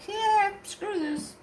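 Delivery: very high, slow drawn-out voice; exasperated quip. Retold or imitated. Seeing seven cars in the drive-thru, high and drawn-out. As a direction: stretch it out, giving up.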